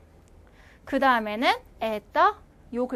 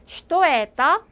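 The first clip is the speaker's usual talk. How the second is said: 슈토에떠 louder and slow, rising at the end; slow prompt; 3 semitones higher, 6 dB louder, slower than usual. Shown as a demonstration. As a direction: draw out 슈토에떠 as a question to the class. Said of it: "어떻게 했다고요?".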